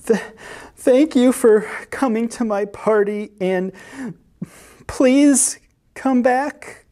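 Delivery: high, halting tearful plea; high pleading little voice; slobbered out. A narrator's reading. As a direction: stammer the start, then plead slowly. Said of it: "slobbered out some simple words".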